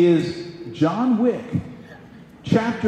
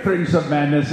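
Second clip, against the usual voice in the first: ordinary delivery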